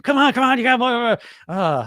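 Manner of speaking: high, loud mock hype of a pushy trainer; rapid-fire mock drill, come on; fitness trainer. Fitness trainer egging on a client, hammed-up high urging. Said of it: "it's the fitness trainer".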